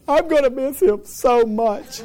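high, slow hammed-up wail; mock crying. Cousin mourning the boy too soon, mock sobbing wail. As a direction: draw it out like a bawling cousin. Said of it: "and they're crying".

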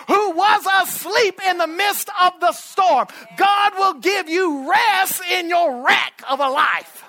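loud, high, rising preacher's shout; full-force preaching. Real, not acted. Preacher promising the congregation rest, building to a full shout.

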